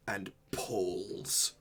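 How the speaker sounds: slow, hushed scene-setting narration; game master narration. Acted. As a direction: draw the players into the scene slowly.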